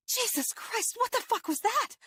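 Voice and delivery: high, panicked outburst after the attack; breathless startled shriek; shocked cry. Acted. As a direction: yell it in fright and disbelief.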